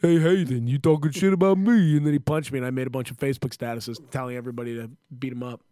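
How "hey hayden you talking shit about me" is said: loud mock Rocky confrontation; put-on boxer voice, louder; kid acting like Rocky. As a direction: imitate a wannabe boxer picking a fight. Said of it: "the kid who thought he was Rocky".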